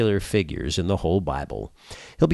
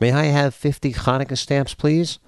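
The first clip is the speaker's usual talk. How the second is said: lightly raised sweet lady voice; polite woman voice; 5 semitones higher than usual. Acted. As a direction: ask it like a prim customer. Woman in joke asks clerk.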